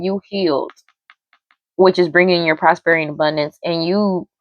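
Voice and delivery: usual speaking voice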